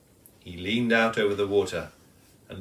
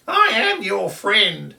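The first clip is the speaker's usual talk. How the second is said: high loud slow laughing reply; 11 semitones higher, 7 dB louder, slower than usual. Acted. Kookaburra reassuring the kangaroo while laughing. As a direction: laugh through the words. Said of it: "laughed Colin".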